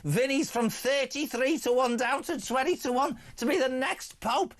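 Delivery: normal talking voice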